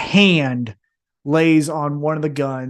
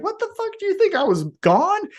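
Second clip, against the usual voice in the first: much higher, mock outraged comeback; hammed-up outraged wrestler; returning wrestler. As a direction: play the returning heel in disbelief. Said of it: "out and is like".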